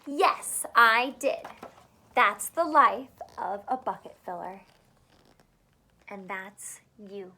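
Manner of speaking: lively picture-book read-aloud; reading to children. Acted. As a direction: read it out to a class of small kids. Reads picture book to kids.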